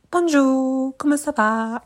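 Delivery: French greeting. Retold or imitated. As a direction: chirp it like the only French you know. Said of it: "all I could say was".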